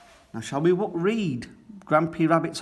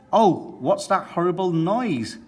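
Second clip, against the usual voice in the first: higher, louder startled outcry; alarmed cry; 6 semitones higher, 4 dB louder than usual. A narrator's reading. Missus Corgi cries out at the hovercraft's noise, startled. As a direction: recoil from the horrible noise. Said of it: "cries Missus Corgi".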